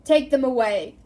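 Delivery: very loud furious command; roaring order. Acted. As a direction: roar at the guards. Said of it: "Crouch roared at the Dementors".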